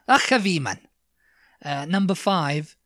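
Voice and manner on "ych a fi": "Ugh a fi" louder and higher, throaty ch; Welsh pronunciation. Shown as a demonstration. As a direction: teach "Ugh a fi" with a rasped first syllable. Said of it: "that's how you say it".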